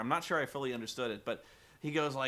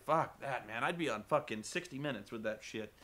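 ordinary talk, nothing marked

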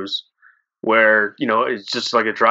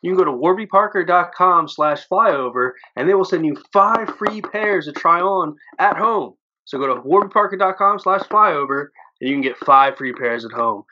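higher-pitched, lively show intro; podcast sponsor read; 6 semitones higher than usual. Acted. Host welcomes listeners and plugs glasses, upbeat podcast ad read. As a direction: announce the show and plug the sponsor.

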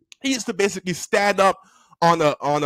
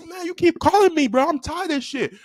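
high, whining complaint; mock exasperation, pitched up; fed-up pupil; 8 semitones higher than usual. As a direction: imitate a kid sick of being called. Acted pupil complaining to teacher.

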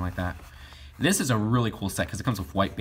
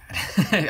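short laugh; 10 semitones higher, 5 dB louder than usual. Real, not acted. Laughs at bad sets.

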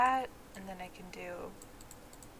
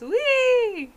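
loud, very high, held squeal; playful high-pitched whoop; gleeful whee; 8 dB louder than usual. Pretend. Player showing how fun it is, loud gleeful whee.

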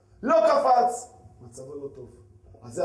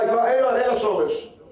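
usual speaking voice

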